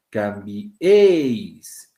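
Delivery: "Cambiéis" very slow and loud on the é; stress on E. Shown as a demonstration. Teacher showing the E carries the force, long stressed é. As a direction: push and stretch the é in "Cambiéis". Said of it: "en la E está la mayor fuerza de voz".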